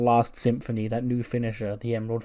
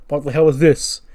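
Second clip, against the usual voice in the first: higher, louder mock yell; furious brute finding the door locked; angry yell; 4 semitones higher, 10 dB louder than usual. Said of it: "And he goes".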